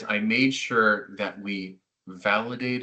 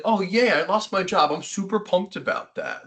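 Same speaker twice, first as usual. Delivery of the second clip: hammed-up fake joy, much higher; sarcastically overjoyed, a mock cheer; mock cheerful; 10 semitones higher than usual. Mocking a happy job loser, hammed-up fake glee.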